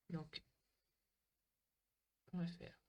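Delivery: usual speaking voice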